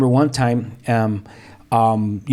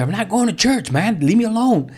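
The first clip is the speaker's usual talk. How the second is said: retold exasperated refusal; 9 semitones higher than usual. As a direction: imitate a son fending off his father. Retold quarrel, son refusing dad.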